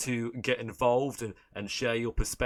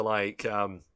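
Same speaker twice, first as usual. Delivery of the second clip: ordinary talk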